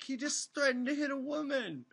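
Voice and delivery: mock an aghast listener, squeaky high; outraged caller imitation. Imitating callers outraged at the DJ, very high. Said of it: "They're like".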